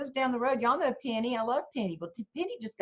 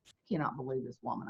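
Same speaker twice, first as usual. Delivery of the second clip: muttered disbelief; 9 semitones lower, 9 dB quieter, much faster than usual. Retold or imitated. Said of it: "And I thought".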